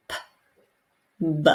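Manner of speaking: crisp P, then voiced B; P then B sounds. Shown as a demonstration. Teacher modeling P and B for Arabic speakers. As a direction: contrast the P and the B clearly. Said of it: "So the P and B".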